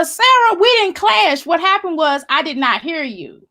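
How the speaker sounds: ordinary talk, nothing marked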